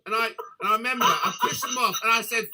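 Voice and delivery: real laughter breaking through the words; can't keep a straight face; giggling through the story; laughing while telling. Real, not acted.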